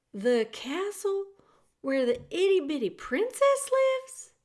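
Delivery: high, wondering question; amazed. Acted. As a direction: ask it with rising wonder. Meg amazed about the castle.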